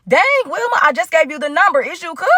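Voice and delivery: high-pitched mock exasperation; exasperated disbelief. New acquaintance overwhelmed by Wilma's constant texting, mock-exasperated. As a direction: push the pitch way up, incredulous.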